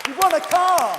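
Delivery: high, loud prize-reveal shout; excited shout. Real, not acted. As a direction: yell it like a game show host. Host announces car prize twice.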